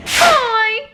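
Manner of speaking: bright high loud greeting; chirpy burst of hello; excited. Real, not acted. Greeting camera cheerfully.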